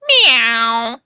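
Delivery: imitating a budgie's cat-like meow. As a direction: meow like the bird did, a mock cat sound. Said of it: "I saw the bird go".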